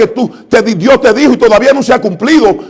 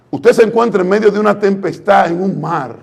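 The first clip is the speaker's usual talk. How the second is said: usual speaking voice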